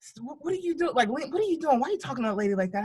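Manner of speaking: put-on a grandma hissing at a rude child; scolding grandma. Grandma scolding the child for talking to the teacher, high and hushed. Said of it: "my grandma goes".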